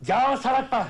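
high, heated outburst; angry dismissal. Acted. Father throwing his son out, angry shout.

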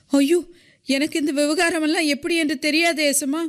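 high-pitched, hurried frightened plea; scared woman protesting, quick and high. A narrator's reading. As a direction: plead in alarm, voice pitched well up. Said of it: "பயந்து போன குரலில் சொன்னாள்".

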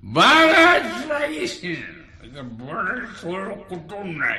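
high, long-drawn cry, loud then fading; caller's wailing outburst. Acted. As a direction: wail it out, stretching the words. (ఎత్తైన గొంతుతో సాగదీసిన కేక)

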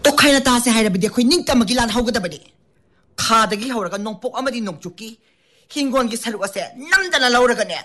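high-pitched, impassioned Shumang Leela monologue; angry stage character. Acted. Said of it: "ꯑꯗꯣ ꯑꯗꯨꯗꯒꯤ ꯃꯇꯥꯡ ꯑꯃ ꯇꯧꯖꯔꯛꯑꯒꯦ".

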